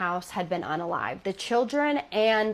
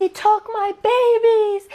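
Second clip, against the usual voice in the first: high, slow anguished scream, much louder; 12 semitones higher, 8 dB louder, slower than usual. Retold or imitated. Grieving mother screaming at old neighbours in McDonald's, anguished scream. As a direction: scream it out in grief, stretched and high. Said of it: "and screamed".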